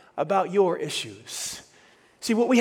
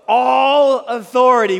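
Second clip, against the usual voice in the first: much louder, drawn-out proclaiming; slow call-and-response; 5 semitones higher, 9 dB louder, slower than usual. Shown as a demonstration. Pastor calling the church to repeat, loud and slow. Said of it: "say it with me".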